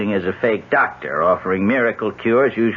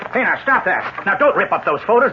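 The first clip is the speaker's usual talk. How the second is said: alarmed shout; 10 semitones higher than usual. Acted. She rips up the photos.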